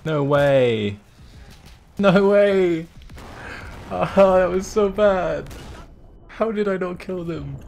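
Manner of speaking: high, loud stunned disbelief; can't believe he missed them. Real, not acted. Gamer tells friend he missed the enemies, disbelieving.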